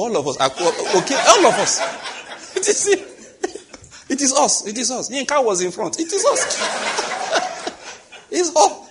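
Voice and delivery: high laughing retelling. Real, not acted. Preacher laughing high while retelling the bride price visit.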